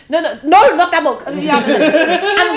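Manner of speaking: loud high flustered no no no; hurried panicked correction between colleagues; loud flustered mock panic with laughter; frantic backstage panic. Frantic coffee-bar chatter.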